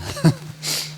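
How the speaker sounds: laughing at his own joke; high laugh. Real, not acted. Preacher laughing at his own joke.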